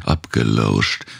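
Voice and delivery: usual speaking voice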